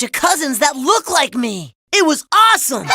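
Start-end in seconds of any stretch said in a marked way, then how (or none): 1.9-2.9 loud, high burst of delight; excited. Acted. Boy telling of his family reunion, excited. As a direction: exclaim it, thrilled.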